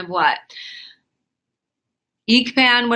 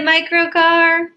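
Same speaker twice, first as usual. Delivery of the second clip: slow, much higher and louder cry of a name; loud high call; 9 semitones higher, 5 dB louder, slower than usual. Real, not acted. Loud high call after losing stream.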